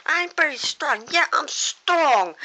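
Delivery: high, loud, emphatic declaration; saying it like meaning it. Acted. Luigi proving to his bandmate he is strong, emphatic. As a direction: belt it out with full conviction, stretching the end. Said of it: "Say it like you mean it".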